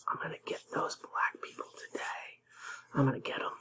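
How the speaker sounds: hushed sinister whisper of an imagined cop. Retold or imitated. Host mocks imagined cop putting on his badge, sinister whisper. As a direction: whisper it like a cop plotting harm. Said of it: "and saying".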